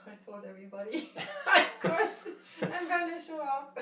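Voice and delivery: loud, high, laughing delivery. Real, not acted. Participant tells the group about announcing her return, laughing. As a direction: burst into laughter mid-sentence.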